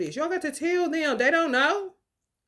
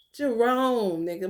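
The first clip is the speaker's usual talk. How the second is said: ordinary talk, nothing marked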